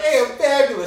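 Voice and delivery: high, loud laughing guy voice; laughing mock intro of a big Italian guy; giant Italian guy. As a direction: announce yourself, cracking up. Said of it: "some guy walked in and was like".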